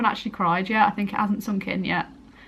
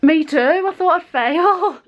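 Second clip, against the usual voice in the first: high, louder, through laughter; laughing relief; 9 semitones higher, 5 dB louder than usual. Real, not acted. Telling mum she expected to fail, laughing with relief. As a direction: giggle it out, relieved.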